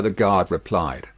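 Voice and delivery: plain reading voice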